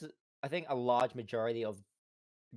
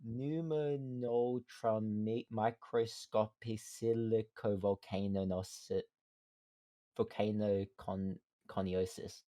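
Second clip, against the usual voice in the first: sounding out a long word slowly; halting, syllable by syllable; slow careful reading; slower than usual. Real, not acted. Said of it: "not even gonna try to say that again".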